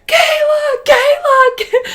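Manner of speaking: high, loud frantic shout of a name; siblings screaming. Retold or imitated. Siblings shout up to sister in the bath, frantic screams. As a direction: yell the name up the stairs, panicking.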